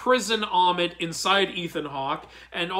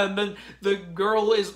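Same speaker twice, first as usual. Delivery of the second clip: ordinary delivery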